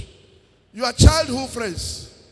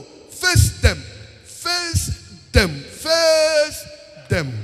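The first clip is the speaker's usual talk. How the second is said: each face them louder and higher, rising to a shout; building exhortation; 5 semitones higher, 3 dB louder, much slower than usual. Real, not acted. Preacher urging his congregation to face old friends, building to a shout. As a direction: drive the command harder every time.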